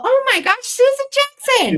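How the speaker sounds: high, loud delighted squeal of a name; light up as a regular shows up; excited greeting. Real, not acted. Host greeting a longtime viewer, excited and high.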